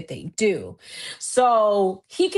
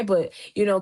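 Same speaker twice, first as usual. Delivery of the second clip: ordinary talk, nothing marked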